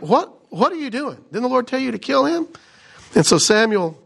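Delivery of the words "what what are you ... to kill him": higher, fast exasperated outburst; mock outrage. Pastor voicing furious Samuel, mock outraged. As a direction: play the prophet losing his temper, lightly. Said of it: "he loses his mind. He's like".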